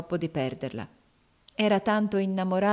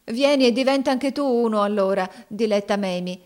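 higher, louder, eager plea; narrated heartfelt entreaty; imploring; 5 semitones higher, 5 dB louder than usual. A narrator's reading. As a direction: beg your beloved to join you. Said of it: "egli la implorò".

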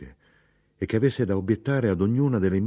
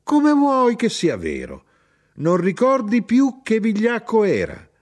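high, loud incredulous outburst; a mother crying out in protest; heated indignation; 12 semitones higher, 6 dB louder than usual. Acted. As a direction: insist on it in a raised, outraged voice. Mother keeps shouting at son.

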